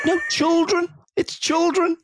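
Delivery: put-on high-pitched, insistent "children"; mock insistent correction. Retold argument over pronunciation, high insistent "children". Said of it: "I'm like".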